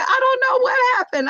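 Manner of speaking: high, sing-song feigned cluelessness; teasing mom's fake innocence; mock innocence. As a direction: play dumb to tease her daughter. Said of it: "I'm gonna go with this".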